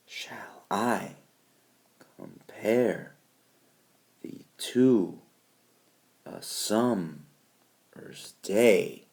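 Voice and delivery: "shall, com, thee, a, -mer's" very soft, then full I, PARE, TO, SUM, DAY; halting pairs with pauses, weak syllable then strong; soft unstressed syllables. Shown as a demonstration. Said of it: "These are the softer ones".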